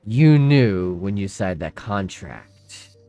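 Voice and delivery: low, loud, slow praise from a looming figure; dark hooded figure. Acted. Hooded figure praising the woman, low and loud. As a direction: speak down from the shadows, deep and heavy. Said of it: "he just looks at her like".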